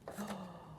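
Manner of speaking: soft storyteller's gasp; quiet gasp of mock surprise; hushed intake of amazement; astonished gasp. Gasp at what he saw.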